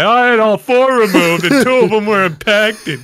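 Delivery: high, loud boastful butt-in; hammed-up one-upper, loud and eager; one-upping guy. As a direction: burst in to outdo the story, pitch way up. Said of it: "There's always that guy".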